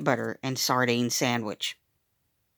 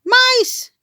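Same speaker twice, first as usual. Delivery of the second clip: put-on girl shrieking; 6 dB louder than usual. A narrator's reading. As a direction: shriek it out in alarm. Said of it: "a girl shrieked".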